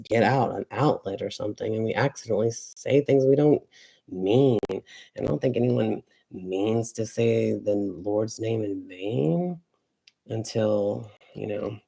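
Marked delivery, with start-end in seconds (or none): none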